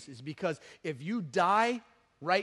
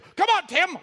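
high, loud eager holler; excited call; 11 semitones higher, 9 dB louder, slower than usual. Retold or imitated. Excited young man calling Tim along, eager shout. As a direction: imitate a fired-up kid calling out.

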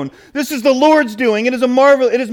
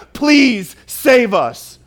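slow, drawn-out begging; crowd's pleading cry; 3 semitones higher, slower than usual. Retold or imitated. Pastor voicing the crowd begging the Son of David, slowly. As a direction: plead with him word by word. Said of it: "here's what they're saying".

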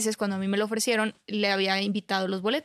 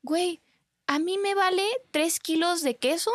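higher, mock-indifferent brush-off; brushing off the boyfriend's tearful plea, pitched higher; dismissive; 7 semitones higher than usual. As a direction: wave him off like you couldn't care less. She dismisses his church plea.

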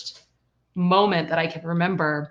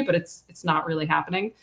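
normal talking voice

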